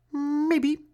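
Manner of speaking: very high chirp; chirping bird. A narrator's reading. Bird answers Franklin with a high chirp. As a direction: tweet it like a little bird. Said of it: "chirped the bird".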